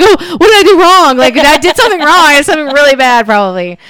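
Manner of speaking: high, loud mock panic; anxious driver after a honk, high and loud; worried. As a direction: play a driver suddenly sure they did wrong. Acting out guilty driver.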